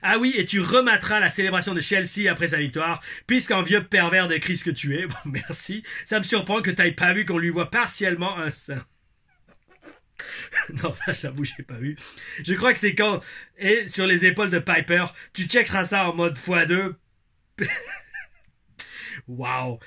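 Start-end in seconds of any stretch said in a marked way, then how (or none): 0.0-8.8 put-on Québécois accent. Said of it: "mon accent québécois". 12.4-16.9 put-on Québécois accent. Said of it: "mon accent québécois".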